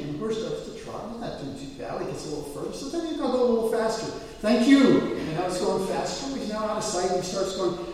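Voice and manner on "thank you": loud, high urging cry; excited shout. Retold or imitated. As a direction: spur the horse on with a yell. Rider urges the horse faster.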